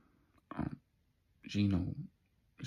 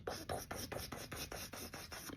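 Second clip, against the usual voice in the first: quiet puffed mock gunshots; soft gunshot noises; 13 dB quieter than usual. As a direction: puff out each shot under your breath. Imitating gunshots.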